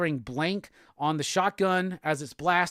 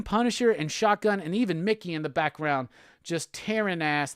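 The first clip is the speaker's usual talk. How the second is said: ordinary talk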